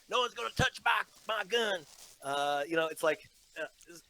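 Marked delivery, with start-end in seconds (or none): 0.1-1.8 a hint of a higher, folksy Southern goober accent; lightly mocking Southern goober accent, gun-proud. Mocking the governor's shotgun ad in a light goober accent. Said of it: "this new goober accent that he kinda made up".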